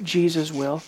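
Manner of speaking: ordinary talk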